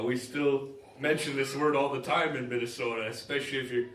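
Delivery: ordinary talk, nothing marked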